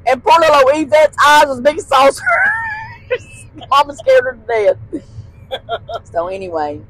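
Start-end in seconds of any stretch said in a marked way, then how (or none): 2.3-3.2 real high squeal of laughter; high laughing squeal. Real, not acted. Recalling mom scaring Yvette, high squealing laugh.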